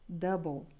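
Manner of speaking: "double" said slowly and clearly, as dɐbo; model pronunciation. Shown as a demonstration. Teacher modelling "double" for her students to repeat. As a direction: give "double" as a model for the class to copy. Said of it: "Repeat, double".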